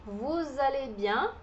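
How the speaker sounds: Vous allez bien said slowly as a model; slow model phrase, linking vous-z-allez; slow French demo. Shown as a demonstration. (Vous allez bien devagar)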